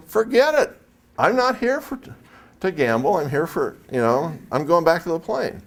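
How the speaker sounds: high-pitched flustered mock protest; high mock refusal. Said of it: "And I go".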